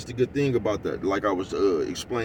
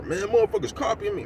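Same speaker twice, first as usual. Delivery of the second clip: much higher, mocking a petty accuser; mock whiny complaint; 11 semitones higher than usual. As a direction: whine it like someone crying copycat. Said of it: "get mad or".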